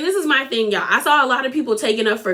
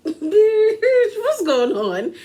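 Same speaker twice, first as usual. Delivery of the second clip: a high, cracking cry of disbelief; a shocked, half-laughing outburst; 8 semitones higher, much slower than usual. Retold or imitated. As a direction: squeal it like you can't believe your eyes. Voicing the shocked table.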